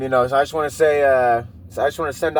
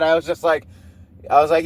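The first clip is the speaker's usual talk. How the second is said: ordinary talk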